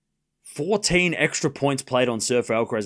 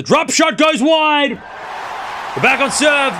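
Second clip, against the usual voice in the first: excited shout; 11 semitones higher, slower than usual. Real, not acted. Fan erupting as Sinner breaks back, stretched shout. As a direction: yell the break-back like a thrilled commentator.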